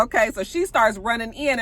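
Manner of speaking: normal talking voice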